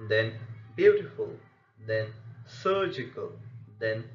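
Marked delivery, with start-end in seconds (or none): 0.7-1.3 "beautiful" with BEAU punched high and loud; "beautiful", high loud start, light ending; first-syllable stress. Shown as a demonstration. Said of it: "first syllable of such a word will be stressed". 2.4-3.3 "surgical" with SUR leading, the ending light; first-syllable stress. Shown as a demonstration. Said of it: "first syllable of such a word will be stressed".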